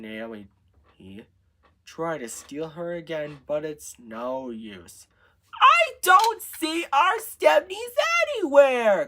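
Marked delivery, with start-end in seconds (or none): 5.6-9.1 loud, high-pitched whine of complaint; complaining. A narrator's reading. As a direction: complain at the top of your voice. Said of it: "complains Sophia".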